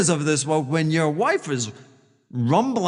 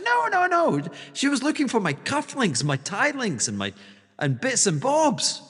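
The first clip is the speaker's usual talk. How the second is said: mock outraged broker; 8 semitones higher than usual. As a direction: squeal it out like a scandalised gossip. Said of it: "He's like".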